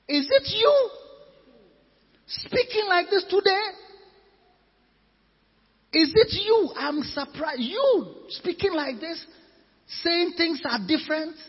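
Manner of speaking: high-pitched, disbelieving questions; incredulous. Acted. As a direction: challenge them in acted astonishment. Uncle shocked at Esther's reply.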